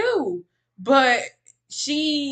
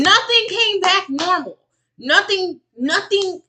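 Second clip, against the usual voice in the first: high, loud, insistent protest; emphatic contradiction; 9 semitones higher, 6 dB louder than usual. Real, not acted. Streamer contradicting a woman's normal test results, emphatic. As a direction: cut in loudly and flatly refuse the claim.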